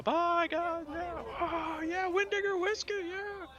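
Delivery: high, rowdy farewell calls; cheering crew. Acted. Ship's crew waving the party off, cheering high.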